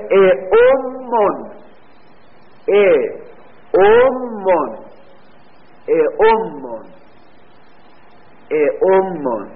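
اعمون stretched out slowly with doubled m; slow demonstration. Shown as a demonstration. Teacher spelling out the answer slowly for students. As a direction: draw out اعمون so every sound is clear. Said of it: "teacher drawing out the answer".

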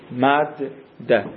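مد ده spelled out slowly, each syllable held apart; drawn-out teacher's demo of مد ده with a clear gap between the words; slow classroom sounding-out; slow pronunciation demo. Shown as a demonstration. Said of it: "مثلاً".

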